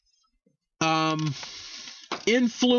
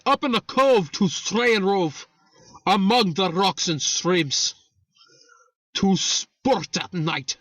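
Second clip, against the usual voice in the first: theatrical mock-Irish poem recital; measured, hammed-up Irish brogue; put-on Irish accent. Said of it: "I will put on my Irish accent".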